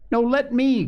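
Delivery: put-on high-pitched, eager boy's protest; storyteller voicing an excited boy; eager cry. A narrator's reading. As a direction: jump in like a kid wanting a turn. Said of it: "cried Joel".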